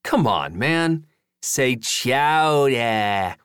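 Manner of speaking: put-on very slow drawn-out New England accent. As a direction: stretch chowder in a hammy New England accent. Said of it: "practicing his New England accent".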